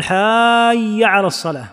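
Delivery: "حي" drawn out long as "haaayya"; overlengthened hayya. Shown as a demonstration. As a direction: slowly stretch the first vowel of "حي". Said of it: "لا تشبع هكذا". (إشباع «حي»: حااايّ على الصلاة)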